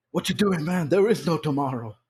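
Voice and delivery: high, intense trainer yelling; Apollo Creed impression. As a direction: push hard like a fired-up coach.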